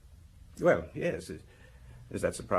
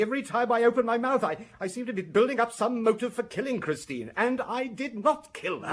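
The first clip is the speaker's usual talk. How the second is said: rising, much higher and louder protest of innocence; angry outburst; 11 semitones higher, 4 dB louder than usual. Acted. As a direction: lose control under questioning. Accused man bursts out.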